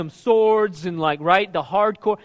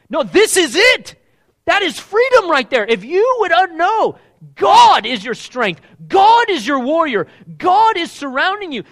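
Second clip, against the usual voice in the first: much louder and higher, slow and emphatic; full-force preaching; 11 semitones higher, 11 dB louder, slower than usual. Acted. Preacher shouting to the congregation about God's strength. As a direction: drive it home to the congregation.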